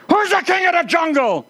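high, puffed-up lion voice; bossy lion. Acted. Lion to the tiger: high, puffed-up lion voice. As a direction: strut it out as the cocky lion, pitched high. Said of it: "And he says".

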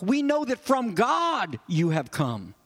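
slow, with "from God" drawn out and emphatic; weighty emphasis on "from God"; stress on FROM GOD. Shown as a demonstration. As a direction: make "from God" the peak, then let "you have come" drop. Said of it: "which gives it the emphasis".

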